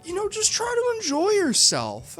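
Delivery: high, airy mock reassurance; mock lady's voice. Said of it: "one of the ladies even says".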